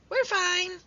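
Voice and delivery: very high tiny squeak; squeaky mouse. A narrator's reading. Mouse answering Hedgehog, high squeak. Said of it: "squeaked the mouse".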